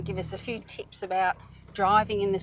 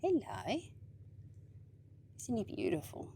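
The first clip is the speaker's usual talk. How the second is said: tender; 9 dB quieter than usual. Real, not acted. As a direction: coo gently at the animal. Greeting a goanna softly.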